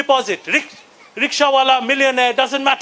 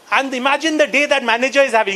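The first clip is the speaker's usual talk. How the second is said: ordinary delivery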